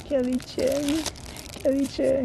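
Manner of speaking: name sung up high and soft; high sing-song name. Acted. Streamer chanting the name he pulled, high and soft. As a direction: call the name in a high lilting tone.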